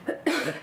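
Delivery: sharp cough, throat blocked; choking cough. Real, not acted. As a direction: cough out the obstruction. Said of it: "choking on something".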